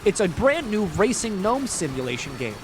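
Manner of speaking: mock spam emailer. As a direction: imitate a fake marketer stiffly pitching a game. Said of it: "they're just kinda like".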